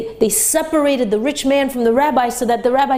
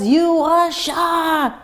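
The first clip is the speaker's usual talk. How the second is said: angry yell; 7 semitones higher than usual. Retold or imitated. Rich man chasing the rabbi over a missing watch, enraged. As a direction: hurl the accusation at the fleeing rabbi. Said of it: "he yells".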